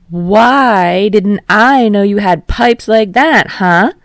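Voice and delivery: loud, high, slow and booming; loud showman. Acted. Yamada praising Kirishima's singing, loud and delighted. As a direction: boom it out with delight.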